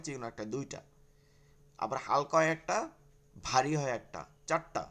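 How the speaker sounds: normal talking voice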